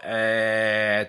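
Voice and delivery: "えー" squashed and drawn out; squashed American A. Shown as a demonstration. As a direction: hold "えー" as a long flattened "æ". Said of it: "ちょっと潰れたような".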